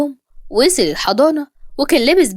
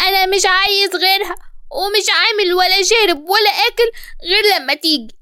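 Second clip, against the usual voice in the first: put-on high, whining crying boy; tearful child; 7 semitones higher than usual. A narrator's reading. Boy refusing food until teacher comes, tearful. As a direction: wail it like a stubborn little boy. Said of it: "علي دموعه نزلت".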